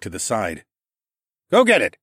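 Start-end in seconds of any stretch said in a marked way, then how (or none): 1.5-2.0 higher, louder coaxing call; urging. A narrator's reading. Narrator urging the bird to fetch, loud.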